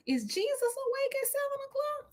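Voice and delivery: high, soft, disbelieving joke; mock the early hour; incredulous aside. Real, not acted. Mocking a seven a.m. appointment.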